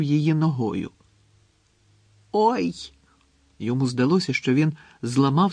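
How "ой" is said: high, short yelp of pain; acted pained "ouch". A narrator's reading. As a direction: cry out at a stubbed toe. Гаррі вдарив ногою стіну. (вигук від болю)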